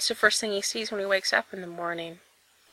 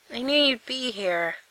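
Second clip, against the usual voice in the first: raspy voice; 3 semitones higher than usual. A narrator's reading. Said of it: "he says in a raspy voice".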